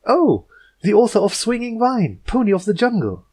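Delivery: bright princess voice. Acted. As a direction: gush a welcome with a winning smile. Twilight greets the author brightly.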